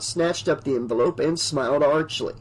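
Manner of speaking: plain reading voice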